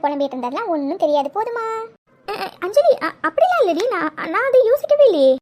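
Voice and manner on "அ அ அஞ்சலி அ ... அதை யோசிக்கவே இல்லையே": louder, stumbling denial; nervous, caught-out stammering; flustered stammer. Acted. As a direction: stutter as if caught out.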